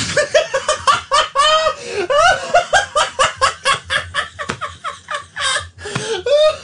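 helpless, squealing high laughter; long high laughing fit. Real, not acted. Host cracking up at his own question, long high laughing fit. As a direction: collapse into a long high giggle.

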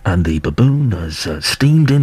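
low, measured nature-documentary narration; documentary voiceover. Retold or imitated. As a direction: narrate it like a wildlife programme voiceover. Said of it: "the voiceover's going".

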